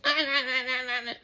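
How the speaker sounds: high, whiny mock tantrum babble. Person told off throws a fit, mocking high tantrum noise. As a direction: mimic a childish fit in a squeaky sing-song. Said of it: "Are you done your tantrum".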